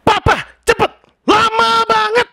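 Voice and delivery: high, loud shout of impatience; shrill hurried demand; harsh snapping yell. Acted. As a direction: yell at papa to hurry. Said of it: "sebuah bentakan".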